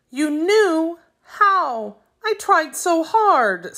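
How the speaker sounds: high, slow, fighting a trembling voice; holding back tears. A narrator's reading. Boy hurt that his struggle was seen, near tears. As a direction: keep the tremble down, barely. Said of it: "struggling to keep his voice from trembling".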